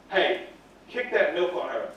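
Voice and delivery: hushed, low-pitched egging on; quiet schoolyard instigator; sneaky urging. Retold or imitated. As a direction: whisper the dare across the table. Friend urging a secret kick.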